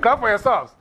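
rally the class into applause; excited teacher's shout of praise; rousing cheer. Real, not acted.

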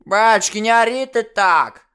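высокий старушечий ворчливый голос, громко; сыграй бабку, отмахивающуюся от крика; недовольная старуха. Acted. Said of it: "недовольно ответил голос". (ворчливая старуха)

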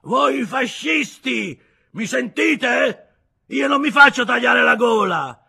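put-on very slow, high, loud drunken yell; shouting. A narrator's reading. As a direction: roar the order at the crew. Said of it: "gridò Kostochkin rivolgendosi alla squadra".

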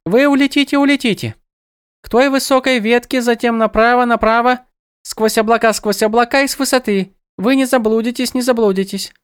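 high, lilting birdlike goddess; sing-song, echoing her own words; bird goddess voice. A narrator's reading. Demigoddess gives directions.

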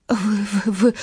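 teeth chattering. A narrator's reading. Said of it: "пробормотала она, стуча зубами".

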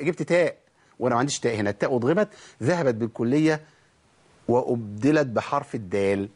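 usual speaking voice

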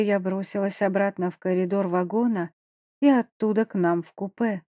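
plain reading voice

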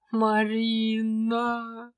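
stretched-out anguished groan of the name; suffering moan. A narrator's reading. The dragon groaning in despair at his stubborn wife. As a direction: moan her name like a martyr. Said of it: "страдальчески простонал он".

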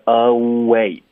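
"Oh, wait" joined through rounded lips into w; linked reading. Shown as a demonstration. Teacher modelling the line, smoothly linked "Oh, wait". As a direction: let "Oh" slide straight into "wait". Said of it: "你的嘴唇会撅起来在结尾".

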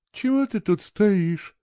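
sleepy. A narrator's reading. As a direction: ask it slowly, as if just woken. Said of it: "сонно спросила она". (сонно, протяжно)

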